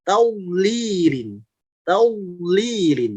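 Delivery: slow "taulirin" with dark "tau" and long "li"; thick correct reading. Shown as a demonstration. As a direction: draw out "taulirin", heavy on the first vowel. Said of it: "cara yang tepat". ("taulirin" pelan dengan awal tebal)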